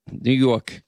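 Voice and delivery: mock New York vowel; mimicked New York accent. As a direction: echo her New York accent back.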